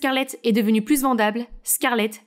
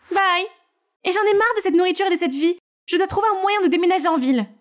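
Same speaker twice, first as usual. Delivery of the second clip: put-on higher, lively teen voices on a call; teen girls chatting; 7 semitones higher than usual. Sisters on a late-night video call, bright and higher.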